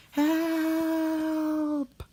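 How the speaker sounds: very high panicked cry; a shrill yelp as he slides; cry for help. Acted. James sliding down the hill, high panicked cry for help. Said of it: "cried James".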